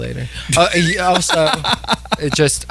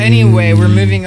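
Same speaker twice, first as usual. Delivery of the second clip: firm, louder dismissal; loud brush-off; 5 semitones lower, 7 dB louder than usual. Real, not acted. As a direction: cut him off loudly.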